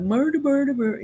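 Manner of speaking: Swedish chef. Retold or imitated. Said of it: "I think of the Swedish chef".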